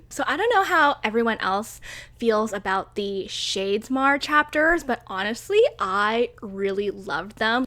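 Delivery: ordinary delivery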